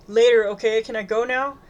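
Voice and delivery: put-on exasperated teenage plea; urgent pleading. A narrator's reading. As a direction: snap back, desperate to leave. Upset daughter wants to escape.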